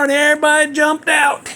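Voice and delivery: ordinary talk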